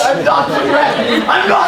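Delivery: frantic denial. Acted. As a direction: deny it in a panic. Angry denial.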